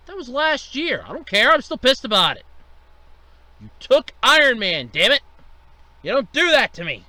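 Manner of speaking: loud, indignant grievance; angry rant. Acted. Boss ranting at Disney over Iron Man, loud. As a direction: still furious about Iron Man.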